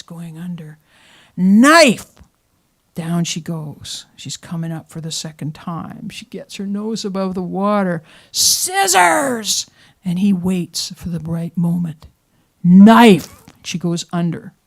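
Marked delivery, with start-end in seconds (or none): 1.4-2.1 loud yell of the word. Retold or imitated. Husband yelling as she sinks. 8.3-9.6 high yell of the word. Retold or imitated. Wife surfacing, yelling back. 12.6-13.4 very loud yell; husband timing his last loud yell as she sinks. Retold or imitated. Husband yelling at right moment.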